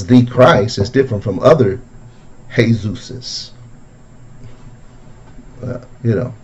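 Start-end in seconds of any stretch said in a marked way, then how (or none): 2.5-3.5 "Jesuses" said softly as hay-ZOOS-es; Spanish pronunciation. Shown as a demonstration. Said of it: "as some people pronounce it".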